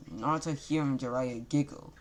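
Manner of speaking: plain reading voice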